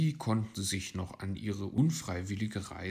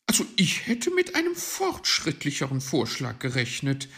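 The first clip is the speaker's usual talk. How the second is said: higher, loud let-down complaint; disappointed magpie; 6 semitones higher, 8 dB louder, faster than usual. A narrator's reading. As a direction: voice disappointment in a raised bird-figure voice. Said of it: "war die Elster enttäuscht".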